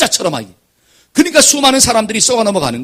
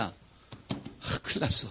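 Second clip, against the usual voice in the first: hushed worry; 9 semitones lower, 10 dB quieter, much slower than usual. Retold or imitated. Overheard woman tells alarming news.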